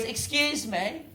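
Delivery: drawn-out haughty pickup; posh indignant. Retold or imitated. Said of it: "she goes".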